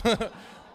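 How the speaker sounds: loud laugh at his own joke; burst of laughter. Real, not acted. Pastor cracking up at his own punchline. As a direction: break into a laugh.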